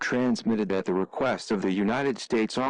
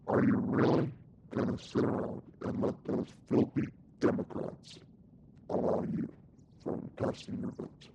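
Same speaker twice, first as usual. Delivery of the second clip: hushed urging; 2 semitones higher, 6 dB quieter than usual. Acted. Rebel urging soldier over radio.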